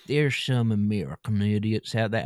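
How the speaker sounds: put-on American idiot accent; hammed-up dim American accent; mock American accent. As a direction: say it as a caricature American. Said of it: "they don't speak like that".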